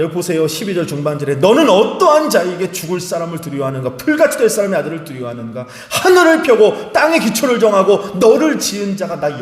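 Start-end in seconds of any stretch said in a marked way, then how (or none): 1.4-9.4 God rebuking. Acted. Preacher voicing God's rebuke.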